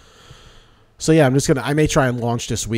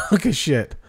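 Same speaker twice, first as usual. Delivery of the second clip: laughing echo; 6 semitones higher than usual. Real, not acted. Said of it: "That should be the name of your first episode".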